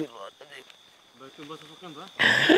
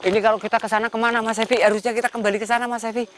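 high, fast frightened pleading; panicked; 9 semitones higher, 4 dB louder, faster than usual. Real, not acted. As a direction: rush it out in panic. Panicked plea while lost in dark.

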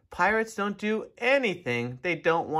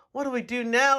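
loud, higher, frightened; panicked yell; 8 semitones higher, 4 dB louder than usual. A narrator's reading. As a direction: yell in a panic as the mast splits. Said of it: "yelled one of the pirates".

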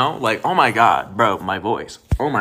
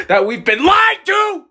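high, loud shout of betrayal; outraged shout; 14 semitones higher, 9 dB louder than usual. Acted. To the barista over pumpkin cream, shouted mock fury.

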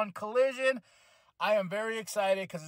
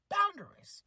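higher, "Boundaries" pitched up as if to an ex; mock firm boundaries; 12 semitones higher than usual. Adam Cole sets boundaries with a clingy friend, higher. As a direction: push back a clingy ex, hammed-up. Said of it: "He's like".

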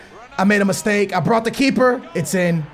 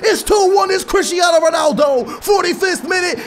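goal shout; 9 semitones higher than usual. Real, not acted. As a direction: scream the goal and the minute. Ronaldo scores.

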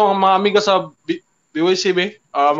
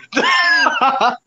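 squealing laugh. Real, not acted. Laughing at own impression.

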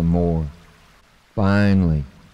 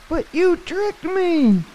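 very high, slow, frightened cry of betrayal; soaked mouse accusing the frog, very high and frightened; scared, accusing mouse; slower than usual. Acted. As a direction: shriek it like a tiny wet mouse who feels fooled. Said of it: "he was scared".

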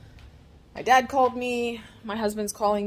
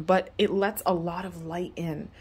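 ordinary talk, nothing marked

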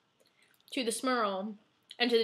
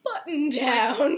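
slow sultry drawl; 3 semitones higher, 5 dB louder, much slower than usual. Retold or imitated. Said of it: "it was like".

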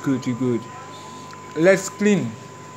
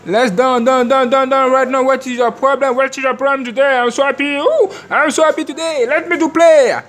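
high, loud, fast game hype; fired-up gamer yelling at the screen; hyped shouting; 8 semitones higher, 4 dB louder than usual. Real, not acted. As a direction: shout it out like the match just started.

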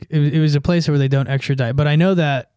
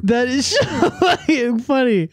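cracking up; 7 semitones higher than usual. Real, not acted. Telling co-host it's so funny, cracking up. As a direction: laugh your way through the sentence.

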